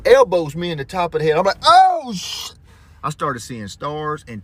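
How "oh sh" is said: sudden high yelp of surprise cut off; pained surprise. Retold or imitated. Dad hit on the head, yelping in surprise. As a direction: blurt it as the pain hits.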